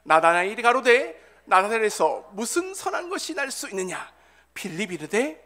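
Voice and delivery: loud, measured congregational reading; scripture read aloud. Acted. Preacher leading the congregation in John's gospel, loud measured reading. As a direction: lead the verse out loud, phrase by phrase.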